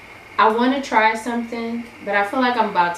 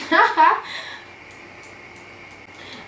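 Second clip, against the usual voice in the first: wheezing laugh; 11 semitones higher, 4 dB louder than usual. Real, not acted. Laughing at her failed liner.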